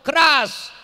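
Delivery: loud, high one-word urging; loud command. Real, not acted. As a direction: call out over the crowd.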